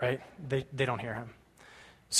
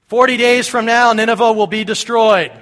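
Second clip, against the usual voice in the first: booming, high-pitched prophet's shout; full-force street-preacher warning; shouted proclamation; 7 semitones higher, 11 dB louder than usual. Acted. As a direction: bellow it to a whole city. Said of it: "it says he shouted this".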